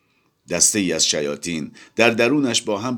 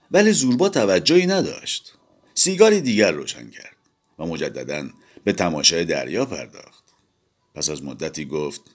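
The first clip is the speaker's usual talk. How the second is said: ordinary delivery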